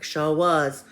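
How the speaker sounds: high, loud put-on Southern reply; crazy Southern accent. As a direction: agree heartily in an exaggerated Southern accent. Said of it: "speaking with this crazy little Southern accent".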